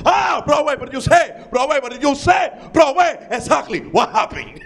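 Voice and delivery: put-on loud, high, eager Spanish accent; bursting to help, Spanish accent; hammed-up excited helper. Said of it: "He got excited".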